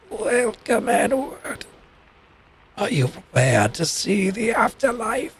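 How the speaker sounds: eerie, drawn-out raspy croak; raspy ferryman. Acted. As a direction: rasp a welcome to the land of the dead. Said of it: "in a very raspy voice".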